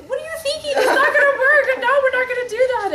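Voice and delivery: high, loud, laughing "what are you thinking"; exasperated outburst. Pretend. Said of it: "sometimes I want to just say".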